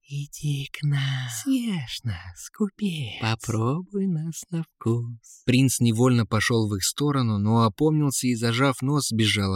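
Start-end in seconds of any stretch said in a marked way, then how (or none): 0.1-5.4 slow, soft, whispery temptress voice; alluring siren whisper. A narrator's reading. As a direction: lure him in with a breathy whisper. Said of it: "манили его ласковыми голосами".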